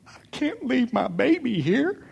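high, soft, wailing mother; tearful wife imitation. Wife leaving son at college, high soft wail. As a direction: voice a mom who can't let go. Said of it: "as my wife went back to the car going".

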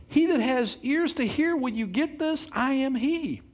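Jesus insisting he has told them all along; Jesus pleading. Acted. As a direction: voice Jesus pressing his listeners. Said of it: "He says".

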